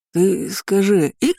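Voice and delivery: put-on slow, drawn-out drunken babble; slurred drunk. A narrator's reading. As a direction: play the lost drunk. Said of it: "продолжал отыгрывать свою роль Отис". (медленно, пьяным голосом)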